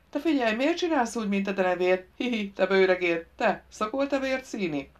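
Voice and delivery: put-on childlike teasing from a daft stranger; village simpleton, playful. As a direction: pester him like an overgrown child.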